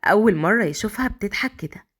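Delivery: usual speaking voice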